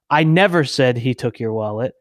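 "never" punched, the rest left unstressed; stress on NEVER. Shown as a demonstration. Showing how emphasis changes meaning, stress on "never". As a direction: hit the word "never". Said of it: "put emphasis on a different word".

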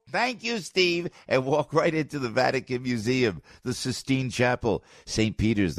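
upbeat radio ad read. Acted. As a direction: sell it like a host ad. Live host read of tour ad.

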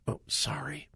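soft unvoiced whisper; whispered oh sorry. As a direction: breathe it out like a sheepish apology. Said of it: "she covers her face and says".

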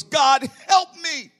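high, loud, slow plea for help; a retold desperate shout of prayer; desperate cry to God. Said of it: "All I knew how to say was".

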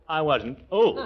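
usual speaking voice

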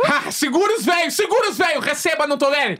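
high, exultant fan outburst; triumphant cheering. Real, not acted. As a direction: crow over your band's announcement. Celebrating Blink in the lineup.

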